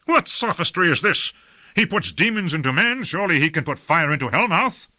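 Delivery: high-pitched, disbelieving argument; indignant retort. A narrator's reading. Lord indignantly disputes wizard's refusal.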